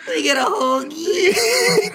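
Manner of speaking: high, drawn-out mocking voice with laughter; mock hoagie order. Friend mocking a hoagie order to his co-host, high and silly.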